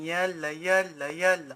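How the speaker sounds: ordinary delivery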